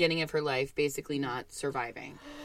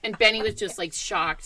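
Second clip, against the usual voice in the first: ordinary talk, nothing marked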